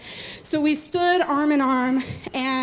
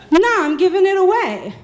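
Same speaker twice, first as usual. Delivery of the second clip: louder, higher shout over the noise; calling back; 5 semitones higher, 5 dB louder than usual. Retold or imitated. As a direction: yell it back across the line.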